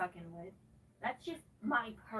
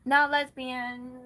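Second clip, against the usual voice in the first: loud; 12 dB louder, slower than usual. Real, not acted. Loud emphatic correction.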